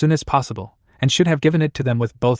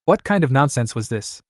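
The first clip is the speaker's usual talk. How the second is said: plain reading voice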